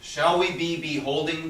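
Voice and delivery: loud, high push on the name; impatient call. Acted. Antonio pressing Shylock for the loan, loud call. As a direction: demand his answer now.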